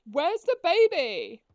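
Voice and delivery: loud, slow panicked call; calling out in alarm; scared horror game streamer. Real, not acted. Panicked search for missing baby.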